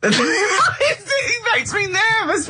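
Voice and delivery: high and slow through laughter; fighting the giggles; words stretched out by laughter; laughing. Real, not acted. Laughing while telling story.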